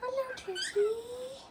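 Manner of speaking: pet talk. Real, not acted. Greeting pet birds sweetly.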